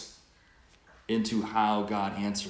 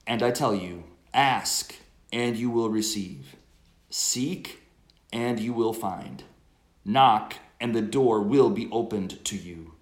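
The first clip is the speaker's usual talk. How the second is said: loud, measured scripture reading; gospel proclamation; 5 dB louder than usual. Acted.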